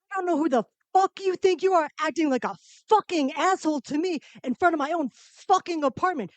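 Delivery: high, fast furious rant; tearing into him, pitched high and fast; retold rant. Comedian retelling a street fight, a fast high furious rant. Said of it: "And I lost it".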